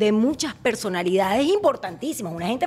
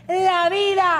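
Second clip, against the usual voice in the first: catchphrase; 7 semitones higher, 4 dB louder, slower than usual. Retold or imitated. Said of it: "Chiqui hizo popular la frase".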